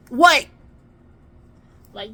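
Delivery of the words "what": high, loud acted retort; loud impatient reply. Mom answering her whispering child, loud and impatient. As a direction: fire it back at full volume.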